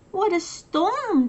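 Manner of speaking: high chirpy wren voice; twittering wren. Acted. Wren chirps about the storm to everyone, high and twittery. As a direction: twitter it like a little bird. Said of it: "twitters wren".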